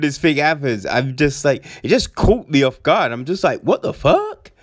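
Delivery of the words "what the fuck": very high, startled outburst; a high yelp of disbelief; shocked outburst. Retold or imitated. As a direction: caught off guard in the cinema and blurting it out. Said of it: "I've just said that out loud".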